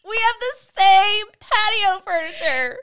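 high, loud, slow, half-crying with laughter; real tearful laughing disbelief. Real, not acted. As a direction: squeal the reveal through laughter. Reveal of matching furniture.

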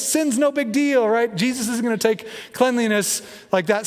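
high-pitched, naive mock nonchalance; airy pretend unconcern, played up; mock breezy dismissal. Mocks a lax reading of Jesus.

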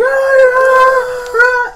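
high loud yelling; orc crowd yelling. Acted. Orcs gathering around, loudly yelling. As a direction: holler like excited orcs.

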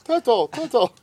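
put-on high, childish TURTLE; little boy's pronunciation. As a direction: mimic a small child saying TURTLE. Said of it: "I love the way he says turtle".

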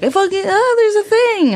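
high-pitched mock child exclaiming; excited kid. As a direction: pipe up like a kid spotting something. Voicing kids spotting shapes.

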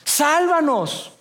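high, slow, desperate plea; panicked cry for help. Retold or imitated. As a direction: cry out as the boat sinks. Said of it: "le gritaron".

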